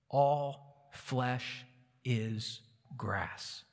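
slow, solemn answer. Acted. Isaiah answering the doubting exiles, slow and solemn. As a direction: space out each word with quiet weight.

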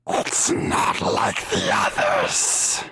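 slow, rasping unvoiced croak; eerie witch voice. Unseen witch sizing up intruders, eerie rasping voice. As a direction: draw it out as a hoarse, menacing rasp. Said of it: "you hear a voice go".

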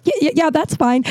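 high, resigned yeah yeah; resigned caller. Retold or imitated. Dawn Marie giving in to the FaceTime, high resigned agreement. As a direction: give in politely.

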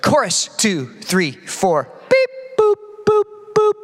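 high electronic beeps, counting in like a metronome voice; click track lady impression. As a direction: mimic the robotic lady in the musicians' earpieces, beeping high. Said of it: "a nice lady who sounds like this".

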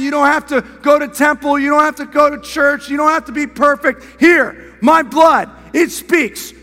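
shouted, high and loud sermon climax; preaching at full force. Acted. Preacher proclaiming freedom to the congregation, full-force shouting.

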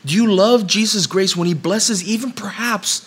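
impassioned preacher's crescendo; preaching at full force. Real, not acted. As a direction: build the sermon to its climax. Preaching builds to climax.

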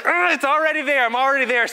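high, put-upon whine with a groan; exasperated groan. Retold or imitated. Dad fed up with parents blocking the map, whiny. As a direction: sound fed up with everyone.

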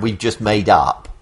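usual speaking voice

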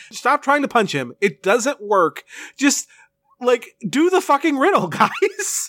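high-pitched, fed-up pleading; exasperated frustration. Acted. Viewer begging the Rangers to solve the riddle, exasperated frustration. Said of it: "the frustration of just being like".

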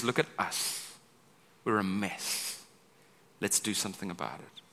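soft, low, earnest; quiet honest appeal. Acted. Nehemiah to the people, soft earnest appeal. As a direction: draw them close, gently. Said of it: "He says".

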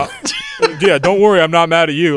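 loud, high imitation of a customer; hammed-up retold insistence; mock insistent reassurance.

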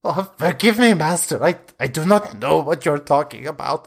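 high, pleading and wretched; a starving vampire begs his master for release; starved pleading vampire. Acted. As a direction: beg like a starving creature locked away. Spawn apologizes to master.

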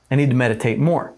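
quick and brisk; fast. Shown as a demonstration. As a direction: rattle it off quickly.

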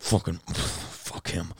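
soft, slow, breathy curse; muttered grumble. Retold or imitated. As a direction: grumble under your breath. Retold muttering at partner.

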